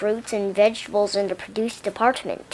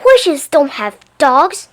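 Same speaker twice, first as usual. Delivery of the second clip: loud, high, slow indignant claim; angry witch; 5 semitones higher, 9 dB louder, slower than usual. A narrator's reading. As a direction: declare it with furious scorn.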